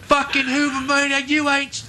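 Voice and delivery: put-on high, raging British boy voice; high mock British brat cursing; mimicking an English kid. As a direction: squeal it like a furious nine-year-old English boy. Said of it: "get my voice up that high to mimic a nine-year-old boy from England".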